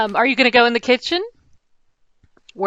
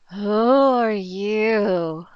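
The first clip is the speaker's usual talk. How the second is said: sleepy waking voice; 3 semitones lower, much slower than usual. Acted. Sleeping paladin wakes up.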